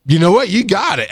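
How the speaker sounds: praising a trainee for her IV; encouraging praise. Retold or imitated. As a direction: cheer her on brightly. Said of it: "I went".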